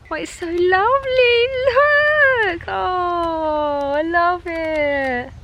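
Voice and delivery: high, teary, overjoyed voice; emotional joy. Real, not acted. Pregnant vlogger sitting on her dream swing, teary with joy. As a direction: gush with happy tears. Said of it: "I'm so emotional".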